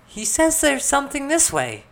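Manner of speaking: very high, loud, delighted voice; smiling excitement. A narrator's reading. Elizabeth interpreting her conjured dog, excited high voice. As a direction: announce it with a big grin. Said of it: "still smiling".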